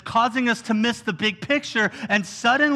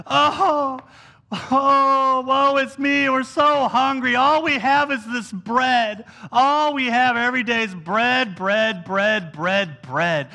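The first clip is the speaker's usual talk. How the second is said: mass complaining; 2 semitones higher, slower than usual. Acted. Imitating the whole crowd taking on the complaint, theatrical moaning. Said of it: "taking on the complaint".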